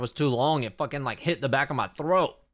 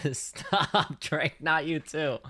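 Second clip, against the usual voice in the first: laughing plea; 5 dB quieter, much slower than usual. Real, not acted. Teased by chat, laughing plea.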